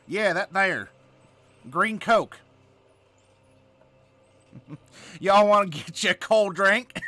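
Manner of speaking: put-on big Southern accent, drawn out; Southern drawl. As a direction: lay on a folksy Southern drawl. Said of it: "the Southern accent or the drawl".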